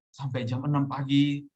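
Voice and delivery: ordinary talk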